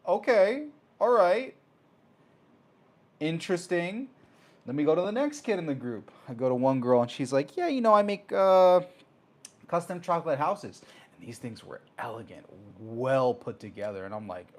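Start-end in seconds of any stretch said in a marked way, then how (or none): 0.1-4.1 a higher, louder "okay, all right", caught off guard; taken aback and trying to play it cool; startled, impressed okay; impressed surprise. Retold or imitated. Reacting to the pricey computers. 7.6-10.8 put-on higher, louder breezy girl; high, chirpy teen girl.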